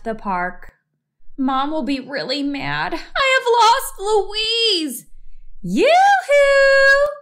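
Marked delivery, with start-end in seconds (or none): 1.4-5.1 hammed-up fearful whimper; near tears. Fox panics over lost sister. 5.6-7.1 sing-song high, loud call from above; little sister calls down, teasing yoo-hoo. Acted. Louise calls from the pole.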